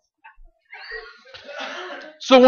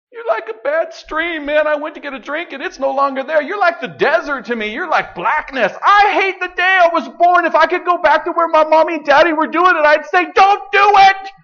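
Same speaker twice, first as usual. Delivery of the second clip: much higher, complaining prophet's wail; whiny Jeremiah; 12 semitones higher than usual. Acted. Jeremiah complaining to God, in a high whiny lament. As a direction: whine it like a fed-up prophet breaking up with God.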